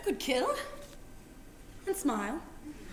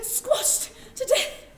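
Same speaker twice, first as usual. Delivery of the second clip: breathy whisper; 8 semitones higher, much slower than usual. Acted. Recalling the killing.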